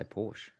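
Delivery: "Porsche" as one syllable, PORSH; habitual short pronunciation. Real, not acted. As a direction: drop the final e of "Porsche". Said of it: "I just say Porsche".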